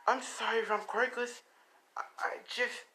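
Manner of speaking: put-on small, high, faltering child voice; apologetic young boy. Boy apologising to elders for being quirkless, meek and faltering.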